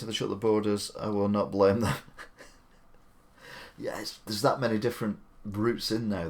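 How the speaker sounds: ordinary talk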